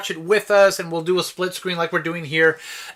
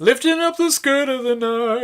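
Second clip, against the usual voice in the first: higher, put-on night-cabbie voice; Bob; 7 semitones higher than usual. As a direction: imitate a cartoon dad's night-taxi line. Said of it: "when Bob was doing the, the night taxi".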